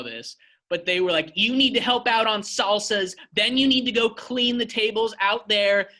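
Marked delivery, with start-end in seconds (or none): 1.4-5.9 higher, pushier manager yell; retold screaming manager, voice pitched up; mock screaming. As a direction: bark orders at the staff. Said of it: "fucking screaming".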